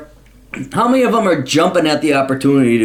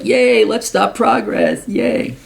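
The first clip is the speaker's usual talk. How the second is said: much higher, slow, sarcastic mock cheer; mock hippie cheer; 10 semitones higher, much slower than usual. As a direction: parody a dopey protester cheering. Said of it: "But it's like".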